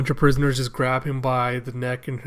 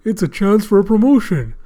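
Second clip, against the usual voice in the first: higher, louder put-on voice; excited glee; 7 semitones higher, 6 dB louder than usual. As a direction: crow over a lucky opportunity. Gifter eager for the glory.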